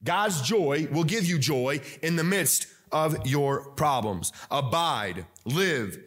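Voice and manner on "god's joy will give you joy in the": emphatic sermon cadence; preaching. Acted. Pastor to congregation, steady preaching. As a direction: press the point home to the congregation.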